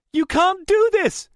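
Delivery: very high, loud desperate cry; shrieked, panicked protest; voice rising, breaking. A narrator's reading. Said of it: "Rachel's voice rose, broke".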